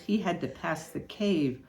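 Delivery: usual speaking voice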